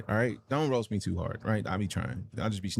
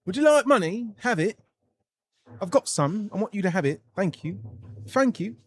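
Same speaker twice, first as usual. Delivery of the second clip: mock generous benefactor, climbing higher; giving out money; 6 semitones higher than usual. Streamer acting out handing money to friends, mock generous voice. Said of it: "getting names of friends and just like".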